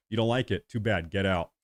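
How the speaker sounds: ordinary delivery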